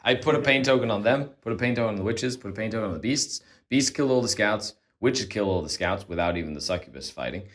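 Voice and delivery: normal talking voice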